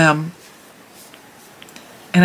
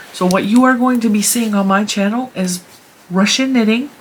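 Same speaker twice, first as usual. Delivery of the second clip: ordinary delivery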